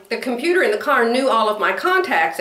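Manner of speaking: ordinary talk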